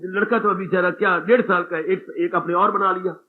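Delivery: usual speaking voice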